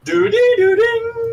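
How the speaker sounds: high, slow sung doo-dee-doo-ding; sung startup chime. Retold or imitated. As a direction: sing the Mac boot chime up high. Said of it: "Is it the one that goes".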